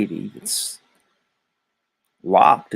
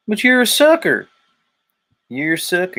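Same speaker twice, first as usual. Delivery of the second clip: high-pitched, taunting mock preacher; 9 semitones higher, 4 dB louder than usual. Grifting preacher to his flock, hammed-up mockery.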